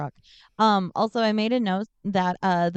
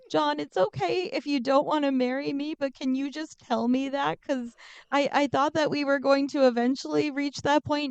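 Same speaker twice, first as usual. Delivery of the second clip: higher-pitched, hurt pleading; acted-out upset, pitched up; retold tearful breakdown; 7 semitones higher than usual. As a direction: plead as if breaking down, retold without real tears. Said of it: "I just broke down".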